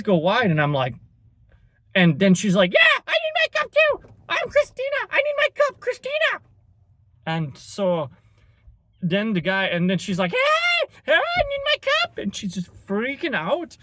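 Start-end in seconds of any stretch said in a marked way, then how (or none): 2.7-6.4 much higher, whiny put-on Karen; mock Karen voice. Karen demanding her cup. 10.3-12.2 much higher, whiny put-on Karen; mock Karen voice. Karen freaking out at the counter.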